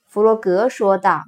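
usual speaking voice

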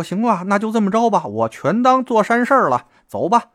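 high, tearful giving-in; near tears. A narrator's reading. Middleman moved by the penniless man agrees to help, near tears. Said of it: "眼泪都快下来了".

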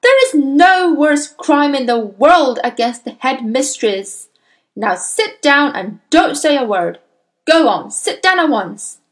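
loud, high, emphatic orders; loud, outraged reprimand; angry yelling. A narrator's reading. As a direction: command her down like a furious headmistress. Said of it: "the Trunchbull yelled back".

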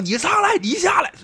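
high-pitched taunting call across a river; mock shouting match. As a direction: imitate two squabblers yelling back and forth. Mimicking two riverbank shouters trading calls.

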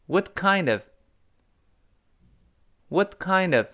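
"What kind of" with "kind" high and "of" linked on; high, clear "What kind of", falling at the end; model reading for repetition. Shown as a demonstration. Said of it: "跟老师读遍".

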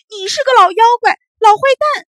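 shrieking loud insult, very high; crying yell. Acted. Bully cries and yells insults at the tumbler doll. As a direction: scream it through tears. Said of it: "哭着嚷".

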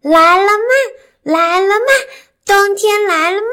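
high, loud calling out; to someone hard of hearing. Acted. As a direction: shout up to a deaf granny. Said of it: "大声地问".

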